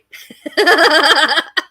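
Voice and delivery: loud rushing whoosh; waterfall roar. Shown as a demonstration. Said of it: "my impression of a waterfall".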